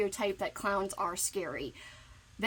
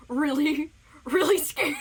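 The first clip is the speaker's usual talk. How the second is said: high, louder squeak on a laugh; 4 semitones higher, 6 dB louder than usual. Real, not acted. Friend nervous about the book swap, squeaky. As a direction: let the nervous words squeak out. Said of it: "That was like a squeak".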